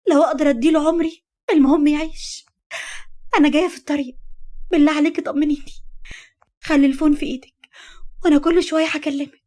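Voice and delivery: weep while making promises; anguished crying. Acted. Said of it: "بكت بقهر وكملت". (بكاء بقهر عالي)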